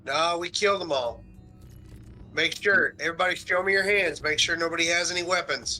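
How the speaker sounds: commanding orders. Acted. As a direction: bark them into line. Soldier ordering captives to show hands.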